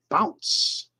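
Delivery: put-on high little kid, rising at the end; kid's voice. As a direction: repeat it as the small kid would. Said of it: "The little kid on the bike".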